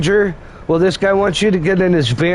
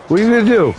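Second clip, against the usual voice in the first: "What are you gonna do?" higher, cocky challenge; taunting; 5 semitones higher, much faster than usual. Acted. Uncle daring the ghost Obi-Wan, cocky taunt. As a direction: dare him, up a notch.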